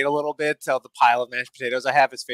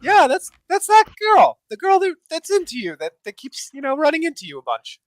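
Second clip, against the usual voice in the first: high, loud teasing nudge; 9 dB louder than usual. Acted. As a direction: tease your friend gleefully. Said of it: "I'll be like".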